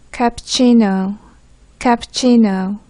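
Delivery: 카푸치노 said slowly with a long "chi"; pronunciation model. Shown as a demonstration. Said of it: "개프쌤의 발음을 듣기 전에". (카푸치노를 천천히 또박또박 발음)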